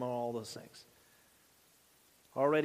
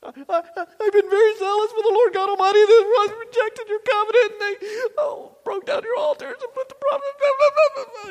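much higher, louder whining complaint; whiny self-pitying Elijah; 9 dB louder than usual. Acted. Elijah complaining to God, high loud whining. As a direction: bleat it out like a sulking child. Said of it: "He's feeling sorry for the self".

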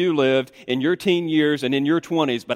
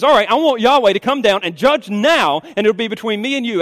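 raised, insistent voice, sure of winning; confident challenge; 7 semitones higher, 5 dB louder than usual. Acted. Wife daring God to judge now, confident challenge. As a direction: demand a verdict, certain of being right. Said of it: "she's so confident that she's in the right".

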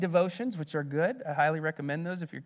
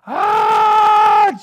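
very high, loud, long mock scream; imitated girl's scream; 17 dB louder than usual. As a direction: shriek like a panicked little girl.

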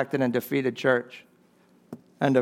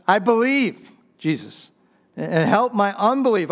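crying out; 5 semitones higher than usual. Acted. Congregation echoing the dad's cry to Jesus, raised and pleading. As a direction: cry out like the desperate dad. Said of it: "can we cry out like this desperate dad does".

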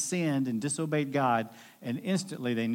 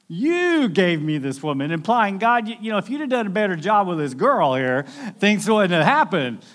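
louder, higher whiny excuse; blaming; 5 semitones higher, 7 dB louder than usual. Retold or imitated. Adam blaming God for the woman, loud whiny excuse. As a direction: push the blame onto God, loud and aggrieved. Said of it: "He's blaming God".

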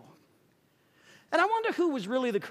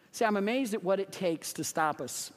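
ordinary talk

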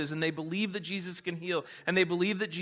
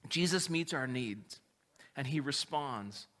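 ordinary talk

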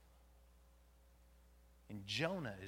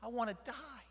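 loud, high desperate outcry; angry cry to God; 14 semitones higher, 17 dB louder, slower than usual. Acted. Jonah crying out to the Lord, loud and desperate. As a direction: hurl it at heaven in anguish. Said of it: "I cried out to the Lord".

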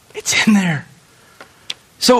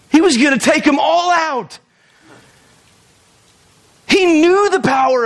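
shout; 4 semitones higher than usual. Real, not acted. Preacher shouting the point.

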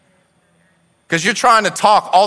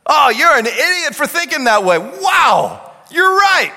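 mock critic and convert; 9 semitones higher than usual. Mocking criticism then fake agreement.

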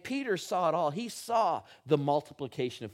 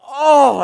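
sudden loud high yelp of pain; walking face-first into a wall, loud pained oh; pained cry; 10 semitones higher, 19 dB louder than usual. Retold or imitated. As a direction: cry out as your nose hits the wall. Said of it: "and I went".

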